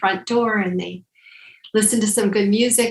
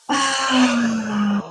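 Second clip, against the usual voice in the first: audible exhale sigh; 3 semitones higher than usual. Acted. Exhale during twist exercise.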